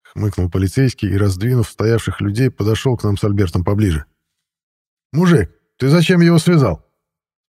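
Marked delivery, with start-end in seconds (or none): 5.1-6.9 higher voice, lightly played policeman; challenging policeman. A narrator's reading. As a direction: question him as a cop would, mildly challenging. (слегка сыгранный полицейский, голос выше)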